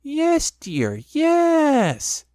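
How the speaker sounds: high, soothing mock Piglet voice; mother mimicking the squeak soothingly; soothing imitation of Piglet. A narrator's reading. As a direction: humour the child in his squeaky voice. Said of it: "soothingly, and imitating Piglet's voice".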